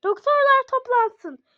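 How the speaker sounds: high, loud hospital page; high loud intercom call; loud announcement. Pretend. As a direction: call the doctors over the speaker.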